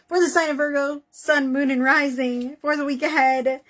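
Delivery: ordinary delivery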